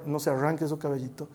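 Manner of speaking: ordinary talk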